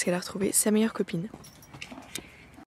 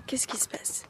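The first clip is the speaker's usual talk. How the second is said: breathy hushed question; whispering; 5 semitones higher, 9 dB quieter than usual. Real, not acted. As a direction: whisper it softly to the horses. Chuchote aux chevaux. (chuchotement doux)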